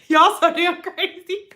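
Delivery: normal talking voice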